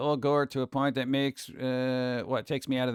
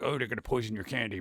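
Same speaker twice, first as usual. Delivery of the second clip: mock spooky warning; 5 semitones lower, 6 dB quieter, faster than usual. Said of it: "there was that thing like".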